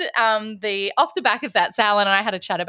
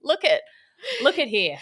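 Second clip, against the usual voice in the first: Irish; 7 semitones higher than usual. Retold or imitated. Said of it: "It's such an Irish thing to say".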